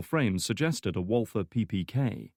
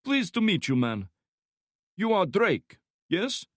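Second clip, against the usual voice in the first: put-on high, loud thick Slavic accent; heavy Slavic accent; 11 semitones higher, 4 dB louder than usual. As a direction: Slavic thug voice, friendly menace. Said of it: "speaking in a heavy Slavic accent".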